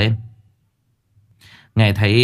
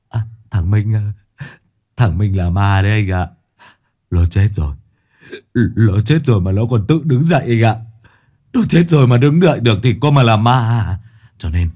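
stammering fear; 3 dB louder than usual. Acted. Frightened youth explaining the ghost.

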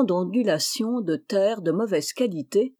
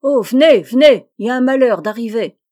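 loud, raised shout for help; urgent cry; 4 semitones higher, 11 dB louder, faster than usual. A narrator's reading. Joseph calls the hunters to an accident, crying out. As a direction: shout the alarm, hurrying after the first call. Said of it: "Puis tout de suite il cria".

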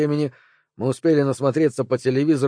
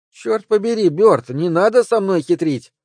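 put-on higher, louder cross child; irritated child; 7 semitones higher, 5 dB louder than usual. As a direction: snap like a cranky kid. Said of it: "тоном раздражённого ребёнка".

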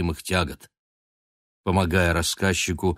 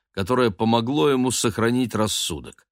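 ordinary delivery

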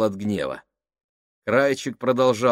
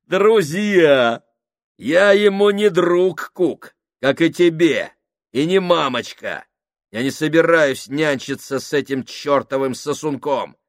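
сильно выше и громче, глумливо; высмей слово «друзья» в полный голос; громкая издевка; 7 semitones higher, 9 dB louder than usual. Acted. Сакс глумится над Куком. (Сакс высмеивает Кука, громко и зло)

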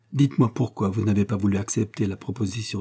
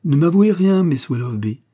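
higher, louder impatient mimicry; mock impatience; 7 semitones higher, 6 dB louder than usual. A narrator's reading. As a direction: imitate an impatient man cutting her off. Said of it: "en contrefaisant son air impatient".

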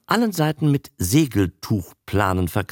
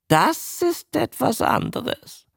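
slippery showman; slower than usual. Acted. Showman backs down.

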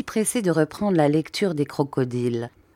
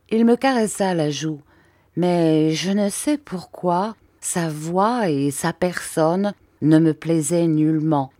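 usual speaking voice

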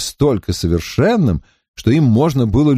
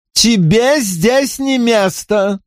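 high, slow, stressing не; slow insistent rebuke; angry; 10 semitones higher, much slower than usual. A narrator's reading. Paul forbids her presence.

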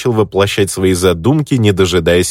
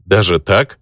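plain reading voice

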